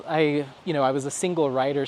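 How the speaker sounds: ordinary talk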